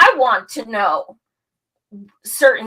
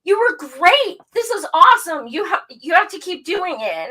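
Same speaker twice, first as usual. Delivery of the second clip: high, loud surprised praise; excited praise; 8 semitones higher, 3 dB louder than usual. Retold or imitated. Tim praising her first interview, high excited voice. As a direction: gush with surprise. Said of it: "And he's like".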